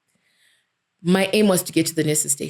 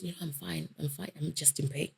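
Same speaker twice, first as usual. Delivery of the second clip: barely voiced, very soft; weak, in pain; 2 semitones lower, 17 dB quieter than usual. To the worried girl beside her, very soft and weak. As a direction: answer softly through the pain.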